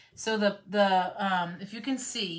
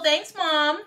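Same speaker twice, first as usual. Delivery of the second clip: playful thanks; 9 semitones higher, 8 dB louder than usual. Real, not acted.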